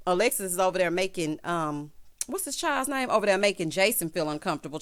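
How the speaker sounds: usual speaking voice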